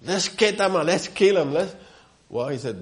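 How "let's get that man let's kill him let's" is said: higher and louder, eager to punish; mock David demanding the man be killed; indignant outburst. David, hearing the prophet's story, calls for punishment in mock outrage. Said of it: "David say right away".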